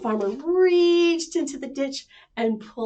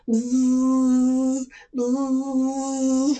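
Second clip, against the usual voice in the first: louder buzzing; 3 semitones higher, 4 dB louder than usual. Shown as a demonstration. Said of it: "Can you make it louder?".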